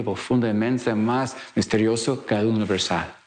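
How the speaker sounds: ordinary talk, nothing marked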